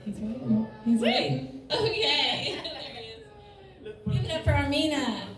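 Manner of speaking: hyped club emcee intro; host voice handing over the mic; MC announcement. Acted. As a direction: bring the next comic up.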